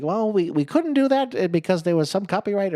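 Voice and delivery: high, loud put-on voice; hammed-up knight excuse; mock knight excuse. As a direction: explain it high and silly. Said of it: "he's like".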